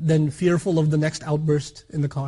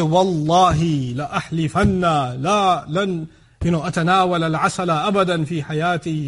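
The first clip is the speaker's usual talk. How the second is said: overblown oath; 3 dB louder than usual. Acted. Husband swearing off honey to his wife, overdramatic mock oath. Counterexample to a gentle promise.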